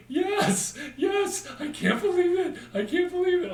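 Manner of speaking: squealing high and loud in disbelief; gushing fan. Retold or imitated. Starstruck fan squealing about meeting Nick.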